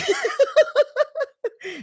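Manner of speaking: very high giggling fit; uncontrollable laughter. Real, not acted. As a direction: giggle helplessly at a high squeak.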